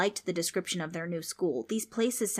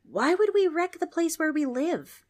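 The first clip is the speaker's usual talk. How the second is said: put-on indignant girl; 10 semitones higher, 6 dB louder than usual. Trix protests.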